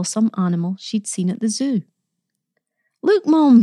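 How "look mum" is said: put-on high, loud boyish eagerness; eager and friendly. A narrator's reading. Boy showing Mum his carved animal, eager and friendly. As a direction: call out brightly to show something off. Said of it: "very eager and friendly".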